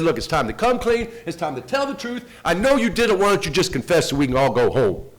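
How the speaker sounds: retold detective demanding a confession, high and loud; forceful interrogation. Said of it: "He bangs on the desk".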